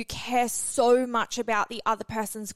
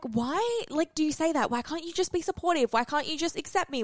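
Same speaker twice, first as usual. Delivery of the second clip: high, pleading whine; mock whiny complaint; 7 semitones higher than usual. Imitating herself pleading with her partner in a high whine. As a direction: play her younger self begging for validation. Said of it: "So I would be like".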